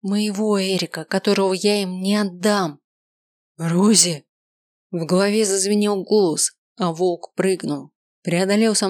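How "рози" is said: calling out. A narrator's reading. Wolf calls her name.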